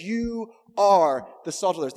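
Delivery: "You are" stretched, with "are" punched loud; emphatic YOU ARE. Retold or imitated. Preacher quoting Jesus to us, emphatic on ARE. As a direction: hold "You", then land hard on "are". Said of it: "speaking emphatically to us".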